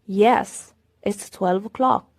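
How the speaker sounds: usual speaking voice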